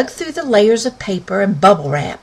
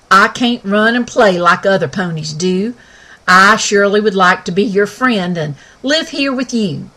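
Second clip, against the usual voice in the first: folksy Western pony, raised and bright; talking pony; 4 dB louder than usual. Acted. Sky speaks.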